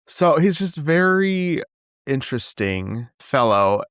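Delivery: normal talking voice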